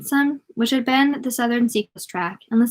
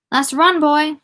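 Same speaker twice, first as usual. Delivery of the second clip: put-on higher, louder call of a drunken trainman; drunken shout; 6 semitones higher, 5 dB louder, slower than usual. A narrator's reading. Drunken trainman calling to boy as train passes, shouting. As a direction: shout it out like a tipsy railwayman. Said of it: "the drunken trainman youted, shouted".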